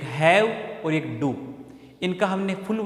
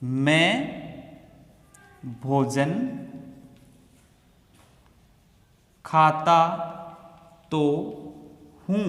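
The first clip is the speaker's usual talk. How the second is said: slow dictation; slower than usual. Shown as a demonstration. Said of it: "word by word translation".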